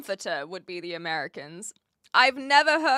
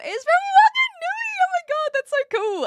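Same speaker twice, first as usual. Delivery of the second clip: much higher, louder delighted squeal; excited squeal; 7 dB louder than usual. Real, not acted. Streamer thrilled a viewer's girl is from Wanganui.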